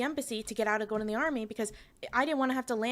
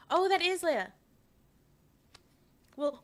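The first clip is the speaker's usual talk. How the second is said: ordinary delivery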